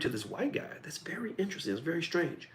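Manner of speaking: hushed, breathy gossip aside; a sly gossiping whisper; mock whisper. Mock whispered aside about husband.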